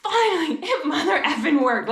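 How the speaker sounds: high, loud laughing cheer of relief; excited cheer. Retold or imitated. Mom cheering her son's first named feeling, laughing and excited. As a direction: celebrate like the breakthrough finally came. Parent celebrating child's breakthrough.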